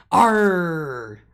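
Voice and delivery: loud high pirate "Arr!"; pirate growl. Retold or imitated. Grandma answering granddaughter at dinner, pirate growl. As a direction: growl it back playfully. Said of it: "I'm like".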